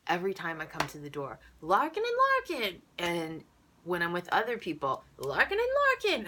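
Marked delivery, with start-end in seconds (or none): 1.6-2.8 high cartoon greeting; hammed-up, loud doorway hello; the Wolf. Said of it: "like a human cartoon called the Wolf". 5.2-6.3 high, loud cartoon greeting; hammed-up hollered welcome; the Wolf. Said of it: "like a human cartoon called the Wolf".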